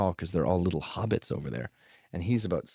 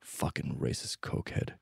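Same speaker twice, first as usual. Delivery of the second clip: softer, whispered disgust; whispered insult; 6 semitones lower, 8 dB quieter than usual. Retold or imitated. A witness at the bar, whispered insult. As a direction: hiss it under your breath. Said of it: "they went".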